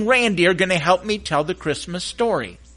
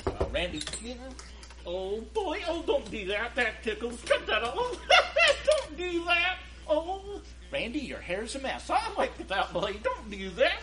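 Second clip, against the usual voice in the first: high puppet voices muffled behind a curtain; muffled puppet banter; 5 semitones higher, 4 dB quieter than usual. Acted. Puppets bickering unseen before coming out, muffled and giggly. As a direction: ticklish puppet squirming offstage.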